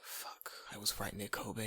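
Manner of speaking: slow, breath-only aside; panicked inner whisper. Retold or imitated. Teacher thinking as pupil says Kobe, acted whispered panic. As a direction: whisper it to yourself, found out.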